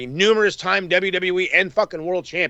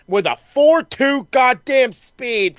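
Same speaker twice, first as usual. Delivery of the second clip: higher, slow, drawn-out hype; hyped up; 7 semitones higher, 4 dB louder, slower than usual. Real, not acted. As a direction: stretch it out like a hype man. Hyping wrestler's speed.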